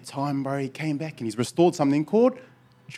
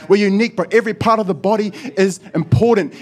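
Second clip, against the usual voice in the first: fervent preaching prayer; loud prayer; 8 semitones higher, 7 dB louder than usual. Real, not acted. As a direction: declare it over the church. Praying aloud.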